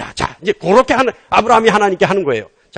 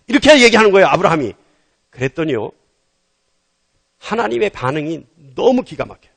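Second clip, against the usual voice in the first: ordinary delivery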